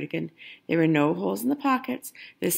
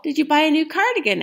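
high, louder questioning granny voice; Grandma eagerly asks about the cardigan; curious Grandma; 10 semitones higher, 5 dB louder, faster than usual. Acted. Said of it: "asked Grandma".

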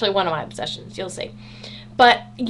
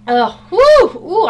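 relieved whoop; 7 semitones higher, 9 dB louder, slower than usual. Real, not acted. Reacting to nasal inhaler.

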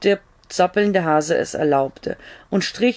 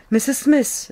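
higher call from the back door; a man calls from the back door, a bit higher; 7 semitones higher than usual. A narrator's reading. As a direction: call her name once more. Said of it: "wieder kam ein Rufen".

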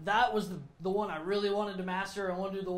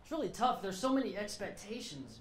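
ordinary talk

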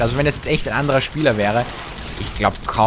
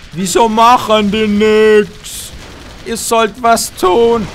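frustrated; 7 semitones higher, 7 dB louder, slower than usual. Real, not acted. Frustrated at units.

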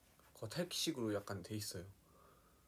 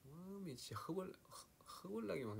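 high, softer Jeolla exclamation; Jeolla dialect; 8 semitones higher, 6 dB quieter than usual. Shown as a demonstration. Mimicking aunts who live in Jeolla, high Jeolla accent. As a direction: lift the pitch into Jeolla dialect. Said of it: "이러면서 막 그러지 않나?".

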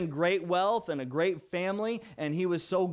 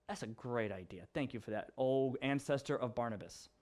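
low, muted deadpan thanks; sarcastic; 7 semitones lower, 8 dB quieter than usual. Retold or imitated. Job answering his wife's terrible advice, sarcastic. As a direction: drop right down into dry mock gratitude. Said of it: "I mean that sarcastically".

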